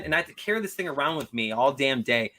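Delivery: normal talking voice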